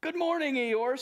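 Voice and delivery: high, bright cheery bear voice; cheery Pooh. Acted. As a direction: greet him like the sun just came out. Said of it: "said Pooh".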